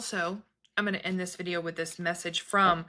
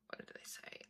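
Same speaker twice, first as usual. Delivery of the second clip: whispered aside to herself; whispering; 23 dB quieter than usual. Real, not acted. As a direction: breathe it under your breath. Trying to remember the quote.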